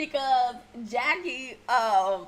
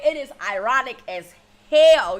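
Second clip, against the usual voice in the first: loud; 8 dB louder than usual. Real, not acted. Mocking the panel's irony.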